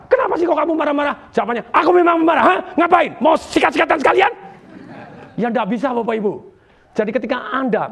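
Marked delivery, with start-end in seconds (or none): 0.1-1.1 quick, higher-pitched retold challenge; challenging question. Asking an angry person why they rage, challenging. 1.7-4.3 loud, high, combative outburst; angry hothead. Acted. Hothead answering back to a challenge, angrily. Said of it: "ketika ada orang pemarah, ketika dia marah-marah".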